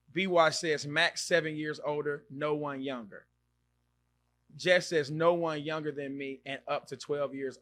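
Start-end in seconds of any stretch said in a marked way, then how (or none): none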